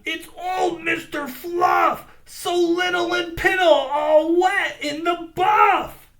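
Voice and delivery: high loud ram voice, slowing at the end; teasing ram. A narrator's reading. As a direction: call it out to the crowd. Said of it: "cried the ram".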